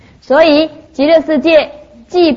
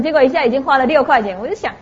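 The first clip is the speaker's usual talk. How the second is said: usual speaking voice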